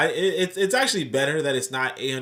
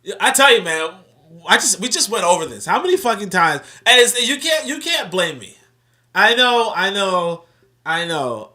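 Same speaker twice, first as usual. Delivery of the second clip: much louder, high-pitched exasperated defence; loud protest; 8 semitones higher, 13 dB louder than usual. Real, not acted. Host defends his misreading to the live audience, protesting loudly.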